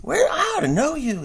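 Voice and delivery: high mocking old man voice; Creepy Joe impression. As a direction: squeak it out like a doddering politician. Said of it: "he says".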